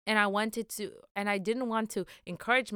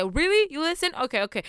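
surprised; 6 semitones higher, 3 dB louder, faster than usual. Retold or imitated. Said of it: "I always stop and say".

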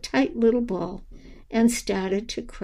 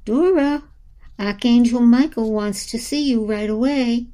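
raised, higher gentle wake-up call; Charming rousing sleepy Dora with a raised call; calling to wake her; 3 semitones higher, 4 dB louder than usual. Acted. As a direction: call her name up high to rouse her.

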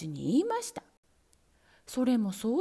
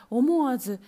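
usual speaking voice

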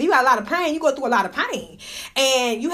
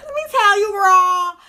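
put-on high shrieking sassy girlfriend; sassy squealing girl; 10 semitones higher, much slower than usual. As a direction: squeal it like a gossiping diva. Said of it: "So I could be like".

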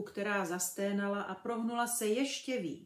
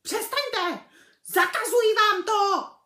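high panicked screech, much louder; terrified shriek; 13 semitones higher, 15 dB louder than usual. A narrator's reading. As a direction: shriek in horror. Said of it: "zaječel strýc Vernon, zachvácený hrůzou".

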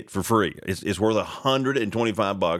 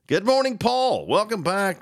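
excited greeting; 10 semitones higher than usual. Real, not acted. Greeting a friend on air.

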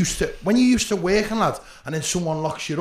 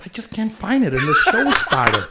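mock fluster with laughter in it; hammed-up distress while blagging your way in; feigned terrible state; 4 semitones higher, 5 dB louder than usual. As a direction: plead as if panicking, drawing out the end. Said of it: "I pretended I was in a terrible state".

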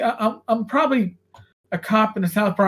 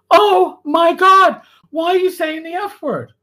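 exasperated mother; 8 semitones higher, 5 dB louder than usual. Retold or imitated. Mother appalled by her son's swearing, exasperated. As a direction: imitate an appalled mom at the end of her patience. Retelling mother's shocked scolding.

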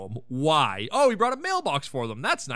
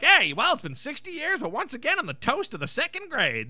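lightly voiced boastful dad, pitched up; proud old man; 5 semitones higher than usual. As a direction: imitate an old man crowing about his success. Martin brags on coming home.

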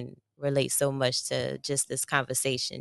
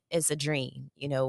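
ordinary delivery

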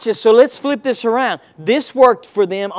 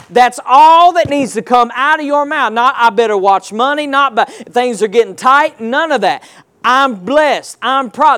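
preaching; 2 semitones higher, 4 dB louder than usual. Acted. Preaching, raised voice.

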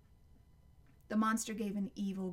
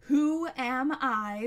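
loud, higher, drawn-out monster roar; still roaring monster; 4 semitones higher, 10 dB louder, much slower than usual. A narrator's reading. Monster holding Connor high, roaring slow challenge. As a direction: roar it slowly, stretching each word. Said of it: "still roaring".

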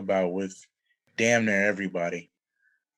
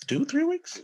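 high incredulous squeak; high squeaky repeat. Shown as a demonstration. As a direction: jump way up in pitch in disbelief.

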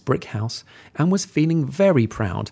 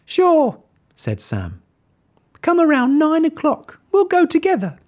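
high, loud pretend cheer; fake friendly pig; 4 dB louder than usual. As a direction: sound friendly, don't mean it. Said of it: "he pretended to be friendly".

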